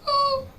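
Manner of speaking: put-on small, very high-pitched baby coo; high, quiet mock baby whimper; sleeping baby noise. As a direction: imitate a baby's soft, high little sound. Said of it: "they go like".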